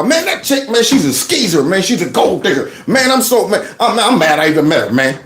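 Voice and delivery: acted disgusted venting to a buddy; trashing his ex. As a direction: sputter indignantly about her. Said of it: "now you're trashing them".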